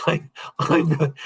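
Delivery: ordinary delivery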